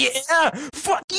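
high-pitched imitation of a triumphant yell; hammed-up victory cry; mock celebration scream. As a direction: squeal it like a gloating winner. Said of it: "someone screams in your face celebrating".

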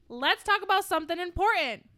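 assertive boss. Acted. Boss ordering salesman, loud and sharp. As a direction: bark it at the salesmen. First take, coffee line.

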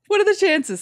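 high, loud "what are the CHANCES"; mock astonishment. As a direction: exclaim "chances" with fake shock.

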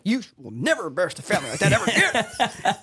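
playfully exaggerated telling-off; mock mom scolding. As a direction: scold like an outraged mom, half laughing. Retells mom scolding at home.

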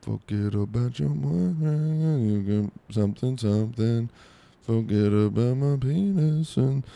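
half-sung lyric mumbled slowly. Pretend. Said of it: "me doing [name]".

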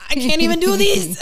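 high, loud whine of defeat; mock exasperated cry. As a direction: moan it like you can't lift another thing. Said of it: "and I was like".

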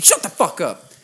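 loud, high mock command; imitated angry dad. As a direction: act out a father shutting someone up. Voicing dad silencing boyfriend.

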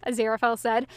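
ordinary delivery, nothing marked

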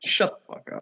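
whispered, joking snap at a sibling; unvoiced playful put-down; whispered retort. Real, not acted. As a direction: hiss it back under the breath. Sibling snapping back.

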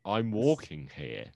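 slow mock New York yell; a hint of a New York cabbie, drawn out; New York accent. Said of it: "another New York thing".

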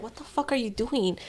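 quiet, hushed disbelief. Retold or imitated. Watching her coworker tally bills, hushed disbelief. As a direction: ask it under your breath.

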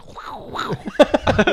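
breathy honking crane sound; crane honk impression. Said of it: "that's the best crane impression".

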